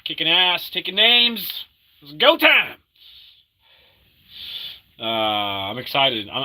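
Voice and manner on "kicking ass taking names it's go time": rising higher and louder hype; building hype. Acted. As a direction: pump yourself up. Rising hype, ending high.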